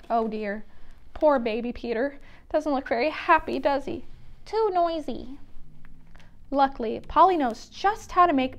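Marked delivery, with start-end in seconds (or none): none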